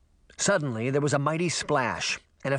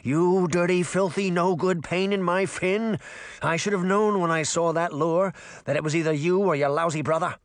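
indignant gurgling fish, pitched up; higher, irritated gurgly fish voice; 4 semitones higher than usual. Acted. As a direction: grumble insults as a talking fish. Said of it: "said in a gurgling voice".

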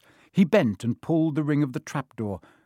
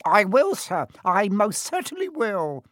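high, eager grovelling imp; obey eagerly in a high, loud squeak; servile poltergeist; 9 semitones higher, 4 dB louder than usual. Acted. Peeves promises the Baron to obey, high servile voice.

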